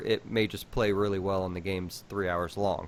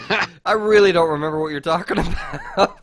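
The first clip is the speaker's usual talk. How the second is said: laughing through the words; words broken by laughter; 7 semitones higher, 9 dB louder, faster than usual. Real, not acted. Laughing at the callback.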